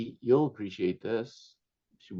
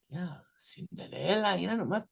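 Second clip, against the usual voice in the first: mock amazed family elder; imitating amazed relatives; 9 semitones higher than usual.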